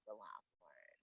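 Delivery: breathy whispered ending. Real, not acted. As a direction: drop the words into a whisper. Private thought trailing off.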